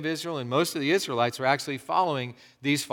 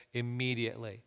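hushed reading; 4 semitones lower, 12 dB quieter than usual. Shown as a demonstration. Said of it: "I underline that word".

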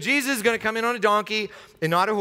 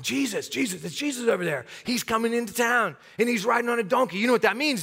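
higher, hushed-excited crowd member; excited onlooker; 4 semitones higher than usual. Acted. Onlooker telling a neighbour Jesus is arriving, excited. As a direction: spread the news through the crowd, pitched up.

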